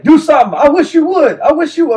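loud, high-pitched imitation of a challenger; mock yelling. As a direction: yell it as a taunting dare, retold. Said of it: "so they're yelling at me".

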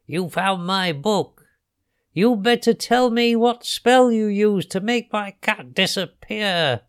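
high witch voice, drawing out the last word; menacing witch. A narrator's reading. Witch threatens the children.

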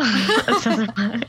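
giggling through the words; laughing. Real, not acted. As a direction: laugh while admitting it. Laughing at own joke.

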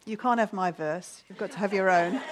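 normal talking voice

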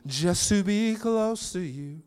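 put-on slow, tender, half-sung as a loving father to his children; drawn out gently like a dad longing for his kids. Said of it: "Papa talking to his children".

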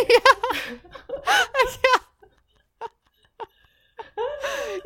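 high helpless laughter; can't stop laughing; laughing hard. Real, not acted.

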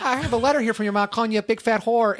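high, nasty interviewer voice; sleazy producer voice. Retold or imitated. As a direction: needle them like a sleazy producer. Producer voice continued.

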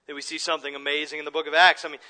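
ordinary talk

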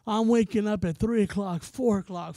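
usual speaking voice

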